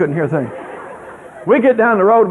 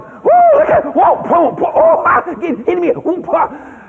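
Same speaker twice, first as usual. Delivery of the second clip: mock frantic hollering of little boys; 12 semitones higher, slower than usual. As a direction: shriek like small boys who got bitten.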